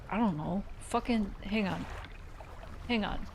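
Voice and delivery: ordinary talk, nothing marked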